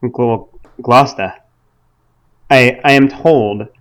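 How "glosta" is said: "Gloucester" with the final R dropped; local pronunciation. Shown as a demonstration. Correcting co-host's pronunciation, R-less "Gloucester". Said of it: "is the correct pronunciation".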